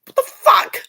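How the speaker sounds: high, loud disbelieving cry; shocked outburst. Retold or imitated. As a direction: blurt it out in total disbelief. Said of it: "And I was like".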